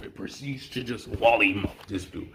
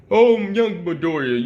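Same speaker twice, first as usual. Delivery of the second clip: loud, high booming-hero greeting; mock hero mentor; 8 semitones higher, 5 dB louder than usual. Storyteller as mentor greeting his student, loud high mock-heroic. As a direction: hail the student in a grand put-on voice.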